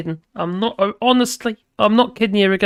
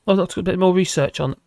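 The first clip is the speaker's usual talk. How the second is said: ordinary talk, nothing marked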